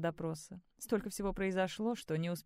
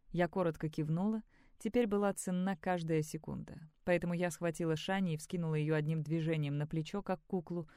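usual speaking voice

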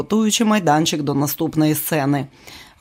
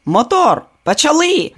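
called out; 10 semitones higher, 4 dB louder than usual. Acted. Calling the take start.

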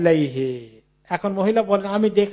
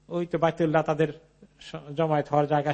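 usual speaking voice

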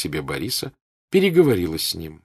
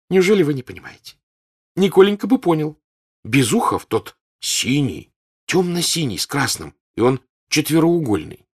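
high, loud, pressing; eager girl; 9 semitones higher, 6 dB louder than usual. Acted. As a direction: daughter begging her mother to understand. Girl insists she is understood.

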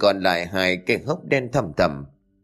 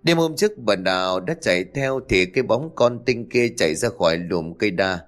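ordinary delivery

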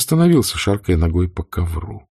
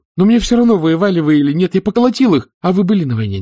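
much higher and louder, words tumbling out; blurted in one breath; 10 semitones higher, 4 dB louder, faster than usual. A narrator's reading. Wade to Uncle Rhett, blurting in one breath. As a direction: blurt it all out before courage fails. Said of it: "на одном дыхании выпалил".

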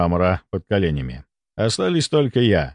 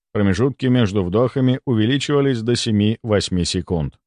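usual speaking voice

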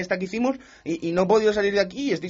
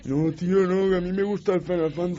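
lightly voiced whiny idiot imitation; mock him with a dopey voice; hint of a silly mocking impression; 3 dB quieter than usual. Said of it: "con esa voz de gilipollas que tiene".

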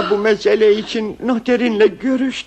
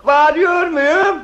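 high, slow, raised-voice question; unwitting shout; 5 semitones higher than usual. Acted. Husband with blocked ears answering wife, shouting. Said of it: "işte şimdi de bağırdı".

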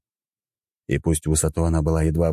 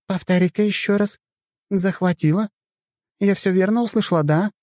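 put-on high, light young woman, doubting. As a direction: voice a puzzled young sorceress. Mirabel's voice, incredulous.

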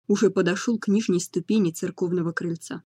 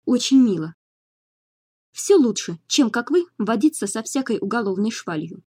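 higher, louder, snapping retort; cutting retort; 3 semitones higher, 4 dB louder than usual. A narrator's reading. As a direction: cut them off sharply. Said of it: "отрезала в ответ Годвин".

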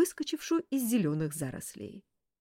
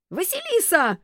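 very high, loud squeal of the name; thin shriek; 12 semitones higher, 9 dB louder than usual. A narrator's reading. Enraged detective screaming at the girl lying lifeless, squealing. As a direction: shriek it in a thin voice not your own. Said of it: "не своим тонким голосом завизжал Кудесников".

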